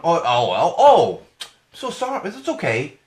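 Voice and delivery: mock a flippant company apologizing; mock apology. Mocking Snapchat's fake apology, high and simpering. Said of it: "does it really work that way?".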